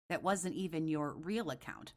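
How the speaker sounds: ordinary delivery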